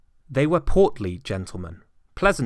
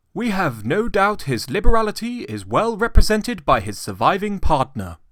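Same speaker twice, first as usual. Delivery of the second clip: higher, courteous, lightly ceremonious; polite portly gentleman; 10 semitones higher, 7 dB louder than usual. A narrator's reading. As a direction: address the old man with formal politeness.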